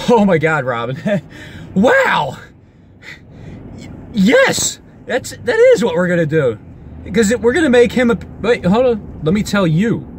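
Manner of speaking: loud, high-pitched burst of excitement; getting excited. Real, not acted. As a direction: blurt it out, carried away. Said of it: "I'm getting a little excited".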